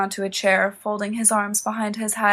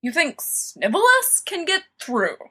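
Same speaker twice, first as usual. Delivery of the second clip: scoff; 8 semitones higher than usual. A narrator's reading. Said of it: "Sirius scoffs".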